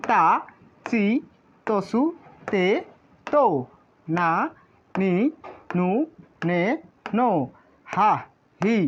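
আ through ন as an evenly spaced syllable drill for learners; pronunciation demo. Shown as a demonstration. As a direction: recite from আ to ন one syllable at a time, pausing after each. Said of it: "আবার উচ্চারণটা একটু দেখি".

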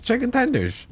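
chewing while talking; words round a bite of food; mouth full. Real, not acted. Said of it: "I'm eating on stream".

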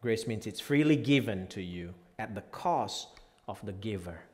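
usual speaking voice

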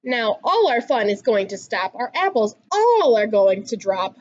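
plain reading voice

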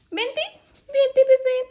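high, loud coaxing call; calling the new puppy over, high and coaxing. Real, not acted.

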